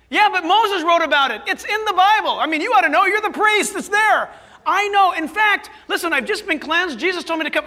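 high, loud, insistent leper voice; eager leper. Acted. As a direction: press the priest, barely containing joy. Acted leper insisting to priest.

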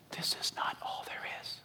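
unvoiced, hushed whisper. Real, not acted. Preacher to his church, real intimate whisper. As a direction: lean in and whisper it.

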